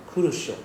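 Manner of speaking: Kuru sho with the de dropped, clipped; casual shortened. Shown as a demonstration. Teacher showing how Japanese people clip desho. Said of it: "they cut this short".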